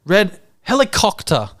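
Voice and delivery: put-on older brother correcting his little sister; older kid correcting. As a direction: correct the sister matter-of-factly. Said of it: "O goes".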